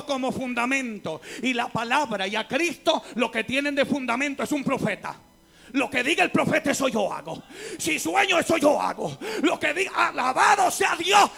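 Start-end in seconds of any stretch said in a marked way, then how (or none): 5.7-11.3 rising into a loud, high shout; full-force pulpit cry; fiery preaching. Acted. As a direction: build up and blast the question. Mocking blind followers, rising.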